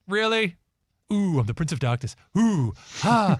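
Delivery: high mocking "ooh", taunting the self-styled dark prince. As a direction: sneer at his spooky act. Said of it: "I'm like".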